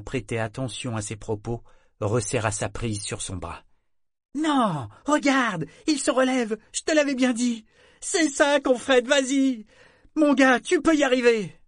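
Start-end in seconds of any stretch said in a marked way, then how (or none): 4.3-11.5 high, loud cheering from the crowd; Clément shouting encouragement. A narrator's reading. Clément crie pour encourager le marin à terre, voix haute. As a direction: urge the fallen sailor up, voice pitched high. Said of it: "les mots que criait Clément".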